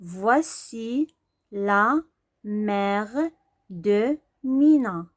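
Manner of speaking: فواسي لا ميغ دو مينا read slowly, word by word. Shown as a demonstration. Teacher sounding out فواسي لا ميغ دو مينا slowly. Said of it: "يعني ها هي مينا".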